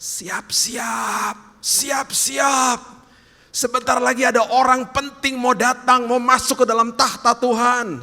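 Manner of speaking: high, ringing call to attention; crier's proclamation pitched far up; heralding shout. Acted. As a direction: shout the announcement to all of heaven. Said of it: "Dia teriak kepada seisi sorga".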